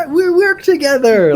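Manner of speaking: high, loud grinning boast; Thor grinning. Retold or imitated. GM imitating Thor greeting a coworker, high loud grin. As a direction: belt it out with a big shit-eating grin. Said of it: "like Thor in Ragnarok when he's like".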